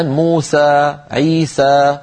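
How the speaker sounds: "موسى، عيسى" ending in a full open ā; pure alif at the end of "موسى" and "عيسى"; open final ā. Shown as a demonstration. Teacher giving most tribes' form, "موسى، عيسى" with open ā. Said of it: "فأغلب القبائل تقول مثلًا".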